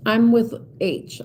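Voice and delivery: normal talking voice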